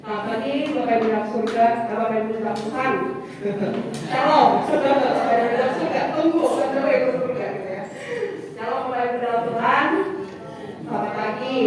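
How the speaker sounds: energetic loud welcome; loud greeting. Real, not acted. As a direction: greet the whole church loudly. Greeting the congregation loudly.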